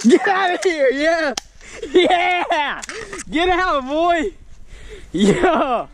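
high triumphant yelling; excited cheer. Real, not acted. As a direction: whoop after landing a shot. Cheering after a hit.

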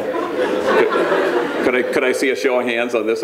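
fast, through a laugh; giggling question to the crowd. Real, not acted. Speaker asks audience for hands, laughing. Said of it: "laughing, asks audience".

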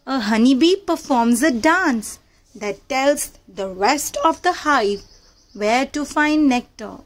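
ordinary delivery

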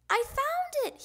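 high, loud discovery shout; excited little frog's shout; triumphant yell. A narrator's reading. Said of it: "he yelled".

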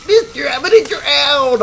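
put-on very high, loud, drawn-out kid voice; panicked little kid. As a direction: squeal it loudly like a kid. Imitates kid afraid of drowning.

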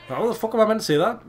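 high, loud, rapid protest; exasperated disbelief. Real, not acted. Player faced with an unpronounceable name, exasperated outburst. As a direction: rattle it out, baffled and indignant. Baffled by a hard foreign name.

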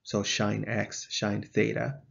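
shine said like the word shine, slow and clear; teacher spelling out shine X, shine theta; British shine. Shown as a demonstration. Teacher showing students the British way, slowly. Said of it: "the British would pronounce it as shine".

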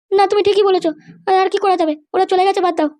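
a high female character voice; mother's voice. Acted. Mother resigns herself.

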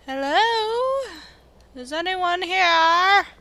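calling into the dark. Acted. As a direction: call out into an empty cave. Calling into cave.

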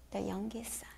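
soft whisper; storyteller whisper; hushed. A narrator's reading. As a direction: whisper it sadly. Hushed narration.

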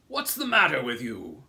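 loud, put-on grasshopper voice; old grasshopper character. A narrator's reading. Old green grasshopper questions terrified James, loud demand. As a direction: demand it loudly as an old bug. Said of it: "the old green grasshopper asked".

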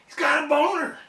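high-pitched holler from the crowd; mimicked heckler. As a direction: imitate one fan yelling out across a silent room. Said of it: "this one dude goes".

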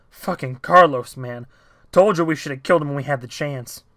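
1 gruff complaint. Acted. Out-of-breath guy complains about Carlos.

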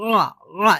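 রা as a squeezed throaty "aa"; ain demonstration. Shown as a demonstration. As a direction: press from mid-throat on রা. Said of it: "এভাবে".

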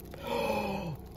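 a sudden surprised intake of breath; breathless gasp of wonder; sharp gasp of awe. Real, not acted. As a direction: gasp at what you've just uncovered. Gasps at mineral find.

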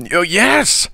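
high-pitched desperate yes; squealed cry of surrender; cried assent. A narrator's reading. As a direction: agree frantically. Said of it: "cried Green".

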